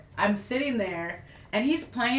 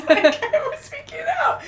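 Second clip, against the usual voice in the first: laughing; 6 dB louder than usual. Real, not acted. Laughing about her panic.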